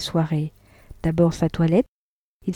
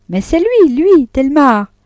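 much higher and louder, outraged; furious cry; 8 semitones higher, 8 dB louder, slower than usual. A narrator's reading. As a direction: hurl his name out in fury. Vatnaz dénonce son amant. (cri furieux, bien plus aigu et plus fort)

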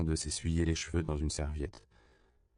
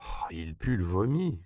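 disgusted comment. A narrator's reading. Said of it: "commenta Esperanza".

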